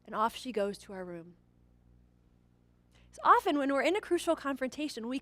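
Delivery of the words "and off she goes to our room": usual speaking voice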